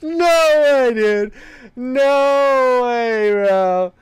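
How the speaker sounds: high, loud, drawn-out disbelief; stunned, stretched-out outcry; incredulous laughing disbelief. Real, not acted. Reacting in disbelief to the voice.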